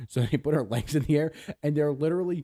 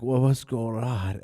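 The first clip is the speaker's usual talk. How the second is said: slow, loud mock slur; drunk; 5 dB louder, slower than usual. Donor waking after fainting, drunken slur. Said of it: "she sounded drunk".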